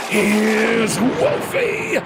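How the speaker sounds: very slow, drawn-out gleeful announcement; menacing wolf entrance. Acted. Wolf bursting into the kids' house, slow and gleeful. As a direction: savour the reveal, dragging every word.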